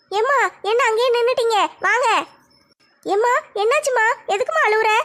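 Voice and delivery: lightly voiced girl worried for her mother; a young daughter asking why mom cries; mild worry in a girl's voice; concerned daughter. Daughter sees mother crying.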